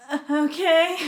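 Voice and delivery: put-on high, loud, doubtful child's reply; mock-puzzled, pitched right up; baffled okay. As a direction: go along with it, utterly unconvinced. Confused child answers grandma's explanation.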